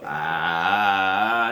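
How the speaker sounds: long, low drawn-out ghost "ah"; eerie sustained moan, sliding slowly upward; ghostly moan. Retold or imitated. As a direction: drone like a creeping ghost. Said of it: "you see a ghost go".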